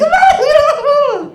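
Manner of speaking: very high squealing gasp; delighted squeal. Retold or imitated. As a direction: squeal with joy at the cat. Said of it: "she's like".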